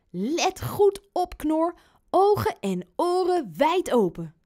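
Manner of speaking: put-on high, emphatic boy voice, drawn-out opening; urgent little boy. A narrator's reading. Boy rousing his cuddly pig to watch, high and insistent.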